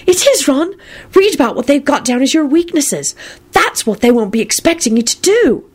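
happy. A narrator's reading. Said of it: "Luna said happily".

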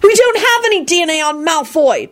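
put-on high, exasperated child's cry; frustrated shout. A narrator's reading. Tim protesting there is no DNA, frustrated. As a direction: cry out high without much volume. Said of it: "Tim shouted, frustrated".

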